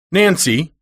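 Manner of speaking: a stern lady calling her kitchen maid; stern mistress calling. Acted.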